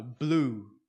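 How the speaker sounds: "Blew" com u longo; blew no passado. Shown as a demonstration. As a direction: diga "Blew" soando blu. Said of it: "Então aqui tá no passado". (blew, passado de blow)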